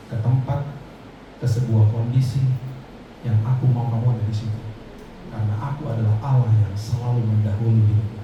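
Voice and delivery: low, steady divine voice; voice of God. Retold or imitated. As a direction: speak as God reassuring him. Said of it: "Tuhan bilang gini sama saya".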